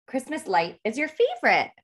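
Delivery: ordinary delivery, nothing marked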